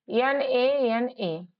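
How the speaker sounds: very slow letters; slow spelling. Shown as a demonstration. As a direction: spell each letter slowly. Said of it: "नाना". (धीरे-धीरे spelling)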